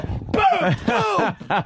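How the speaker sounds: high, punchy "Boom" sound effects for the door hits; door slam noise. Retold or imitated. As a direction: bang out each "Boom" like the car door smashing his leg. Acting out slamming the door.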